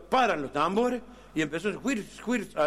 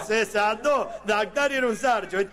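ordinary delivery

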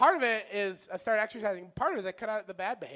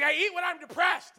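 mock defensive yell; 9 semitones higher, 4 dB louder than usual. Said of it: "I'm like".

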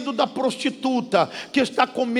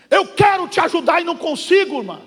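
raised retold reply; 6 semitones higher, 5 dB louder, faster than usual. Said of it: "O que que eu falei pra ela".

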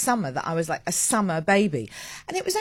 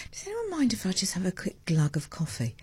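softer, conspiratorial whisper; whispered request; 5 dB quieter than usual. Real, not acted. Host whispering to listeners about a coffee sip. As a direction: whisper it like a secret.

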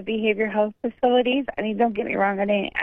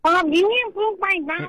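high squeaky cartoon voice; cartoon character voice; 8 semitones higher than usual. Real, not acted. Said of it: "Why do you talk like a cartoon character?".

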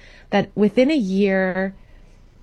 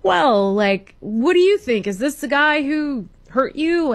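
higher-pitched, hammed-up questioning judge; mock judge; 7 semitones higher than usual. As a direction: imitate a judge prompting the accusers. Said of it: "the judge is like".

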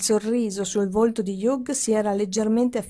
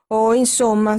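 snapping out; 7 dB louder than usual. A narrator's reading. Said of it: "sbottò Helen".

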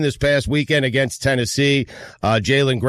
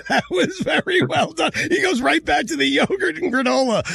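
high laughing shout; 12 semitones higher than usual. Real, not acted. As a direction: crack up while talking. Host laughing at the dodge.

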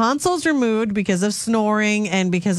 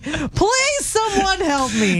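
mock despair; 8 semitones higher, slower than usual. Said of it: "I'm like".

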